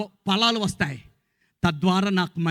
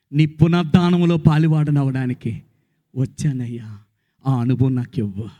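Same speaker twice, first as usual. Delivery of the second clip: prayer; 4 semitones lower than usual. Acted. Models a prayer to Jesus.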